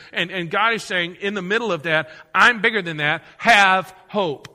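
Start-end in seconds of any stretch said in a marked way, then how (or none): 2.3-4.5 louder, proclaiming as God; forceful divine proclamation. Acted. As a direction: declare it like God speaking over the darkness. Preacher voicing God's declaration.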